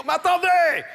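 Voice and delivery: high, loud outburst of impatience; exasperated shout. Acted. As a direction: shout to stop the interruption. Le créatif coupe le directeur. (cri exaspéré, aigu et fort)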